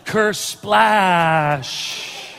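a mouthed splash sound effect; a playful imitation of a splash; mock splash noise. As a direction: make the noise of someone hitting the water.

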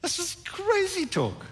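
very high, drawn-out scoffing; incredulous dismissal. Acted. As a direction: play up the disbelief, high and slow. Preacher performs labelling a lie crazy.

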